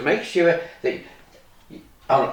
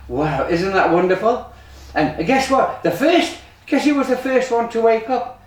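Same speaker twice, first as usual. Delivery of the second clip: usual speaking voice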